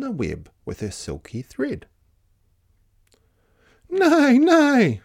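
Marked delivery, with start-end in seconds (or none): 3.9-5.0 high, loud whinnying neigh; horse's neigh. Acted. Horse greeting the busy spider, high neighing. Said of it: "said the horse".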